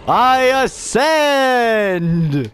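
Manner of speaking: hammed-up godlike announcement; grandiose drawn-out proclamation. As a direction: stretch it slowly, high and grand. Mock grand ascension.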